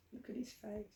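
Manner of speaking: hushed aside. Acted. Narrator showing children the cat's guilty face, hushed and tender. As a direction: point it out gently to the children. Pointing at the disgraced cat.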